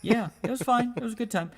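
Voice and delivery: mock cheerful. Mock upbeat reassurance.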